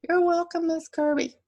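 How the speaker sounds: put-on high, loud boyish voice; proud kid. Bobby replies to his teacher, high and loud. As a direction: beam it out proudly.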